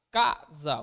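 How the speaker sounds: "Casa" split in two, strong first syllable, dropped second; stressed first syllable. Shown as a demonstration. Teacher isolating the tonic syllable, "Casa" with emphatic first half. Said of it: "A sílaba tônica de casa é o K".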